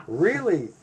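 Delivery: high, loud burst of interest; excited. Retold or imitated. Battalion hearing of a European barber, excited. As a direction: pounce on it with loud, excited surprise. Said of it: "they got all excited".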